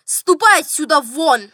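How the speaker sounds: loud angry dismissal; angry general. A narrator's reading. General throwing the steward out, angry and loud. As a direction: order him out forcefully. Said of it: "Рассердился генерал".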